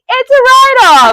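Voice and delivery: very high, loud, slow squeal; excited shout. Retold or imitated. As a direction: squeal it out together, thrilled. Both say it together, retold.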